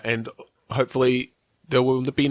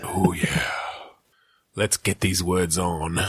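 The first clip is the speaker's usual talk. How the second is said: low breathy seductive whisper; sexy voice; 5 semitones lower, slower than usual. Pretend. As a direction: whisper it low and sultry, slow. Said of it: "wonderful, sexy voice today".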